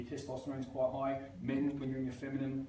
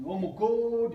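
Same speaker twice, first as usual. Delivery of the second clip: loud, pitched-up Oh my God; mock loud exclamation; 6 semitones higher, 8 dB louder, slower than usual. Aggressive client meeting him, loud exclamation. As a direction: blurt it like a brash aggressive woman.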